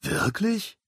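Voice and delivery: high, eager disbelief; light up at good news; surprised. A narrator's reading. Poe hears he is the cook's helper, surprised and delighted. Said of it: "fragte Poe überrascht".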